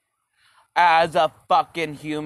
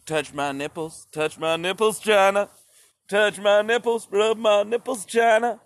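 a sleazy come-on that grows into a pleading cry; mock NBA flirt; 4 semitones higher than usual. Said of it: "N B A is like".

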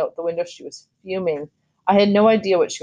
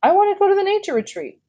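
put-on very high, fast childish plea; about to cry; 4 dB louder, faster than usual. A narrator's reading. Boy begging to go on the trip, tearful plea. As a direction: plead in a high voice, near tears. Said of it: "really was about to cry".

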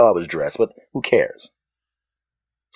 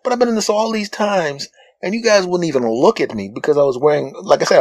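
ordinary delivery

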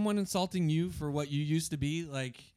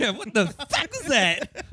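slow, high mock disbelief; shocked; 10 semitones higher, 6 dB louder, slower than usual. Imitating Becky's reaction.

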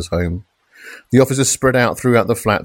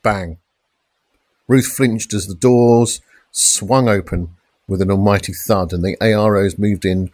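ordinary delivery

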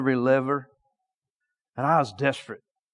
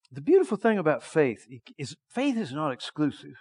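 ordinary delivery

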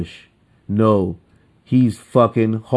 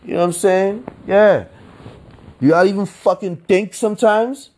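exasperated rant; 10 semitones higher than usual. Real, not acted. Ranting at stupid voters.

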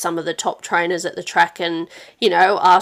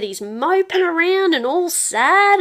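mock Mum; 10 semitones higher, slower than usual. Daughter retelling Mum's worry about Dad, mock Mum voice. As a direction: play Mum, a bit scandalised.

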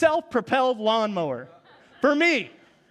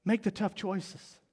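gentle voice of Jesus; 3 semitones lower, 7 dB quieter than usual. Retold or imitated. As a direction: reassure them with love. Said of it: "And Jesus is saying".

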